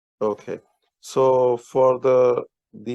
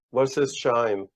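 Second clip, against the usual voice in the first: usual speaking voice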